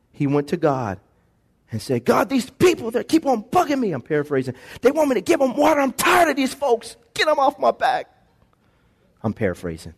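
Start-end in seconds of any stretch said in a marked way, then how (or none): 2.1-8.1 higher, whiny and fed up; exasperated complaint. Pretend. Moses complaining to God about the people, higher and fed up. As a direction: moan like a leader sick of his people. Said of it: "Moses was mad at the people".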